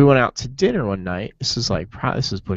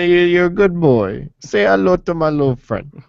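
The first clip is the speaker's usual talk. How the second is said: higher, louder hammed-up gangster line; mock movie gangster; 6 semitones higher, 3 dB louder than usual. Joking about Goodfellas, a hammed-up movie gangster voice. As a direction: ham up a movie mob boss.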